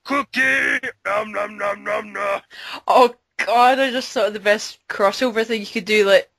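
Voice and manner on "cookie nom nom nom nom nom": Cookie Monster munching. Acted. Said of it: "Cookie Monster".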